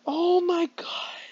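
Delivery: slow stunned whisper; real shocked whisper. Real, not acted. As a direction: breathe it out, voiceless. Shocked by the game.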